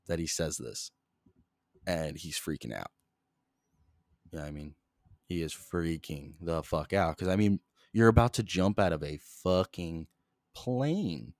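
normal talking voice

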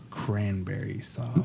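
low, soft and very slow; deadpan reveal. Retold or imitated. Buddy Rogue gives the secret password, slow and deadpan.

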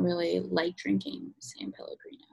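whispered confession. Real, not acted. Admitting to listeners she dislikes the drink, whispered. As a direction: whisper it like a guilty secret.

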